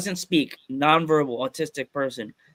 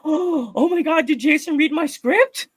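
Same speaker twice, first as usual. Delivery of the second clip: high, re-enacted weepy disbelief; tearful shock; 13 semitones higher, 4 dB louder than usual. Fan seeing the trailer, high tearful astonishment. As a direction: imitate crying out in amazement. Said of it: "I just started crying".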